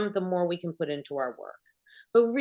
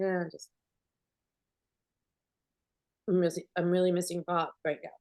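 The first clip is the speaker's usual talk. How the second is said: quiet real sadness; faster than usual. Retold or imitated. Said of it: "What just happened?".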